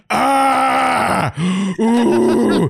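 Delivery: long high mock scream into a high "ooh"; imitated ice-bath shriek, drawn out; freaked-out yelp. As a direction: wail high as if hitting freezing water. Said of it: "he's, like, freaking out".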